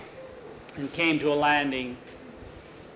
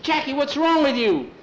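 alarmed shout; 10 semitones higher, 4 dB louder than usual. Acted. Brother shaking dreamer awake.

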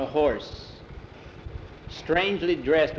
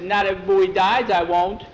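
higher, louder protest; upset, raised-voice refusal; defiant retort; 5 semitones higher, 6 dB louder than usual. A narrator's reading. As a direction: push back hard against the comfort. Child rejecting adult's comfort.

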